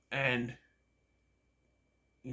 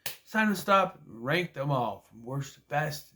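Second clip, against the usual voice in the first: ordinary talk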